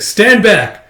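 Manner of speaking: high, loud command; retold defensive yell; acted warning shout. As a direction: order them away sharply. Retold warning to keep away.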